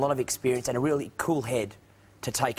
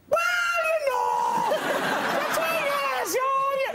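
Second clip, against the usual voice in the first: squeaky, shouted Irish impression way up high; mock Irish accent; 3 dB louder than usual. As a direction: ham up an excitable Irish singer. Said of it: "He kinda goes subsonic".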